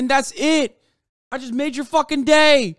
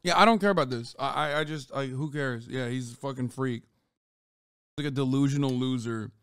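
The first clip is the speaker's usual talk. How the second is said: much softer and lower, tossed off; dismissive aside; 9 semitones lower, 9 dB quieter than usual. Real, not acted. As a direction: mutter it off-mic, not caring. Streamer dismissing clip, turned away.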